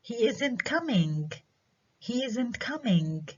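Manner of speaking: high, slow "He isn't coming", pitched as a question; statement as question. Shown as a demonstration. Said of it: "إذن rising intonation".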